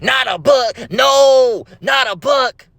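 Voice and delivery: high, loud cry of mock horror. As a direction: recoil in exaggerated alarm. Ninja horrified by a book.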